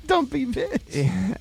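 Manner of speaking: mock insult. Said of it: "she's a big, fat, dumpy bitch".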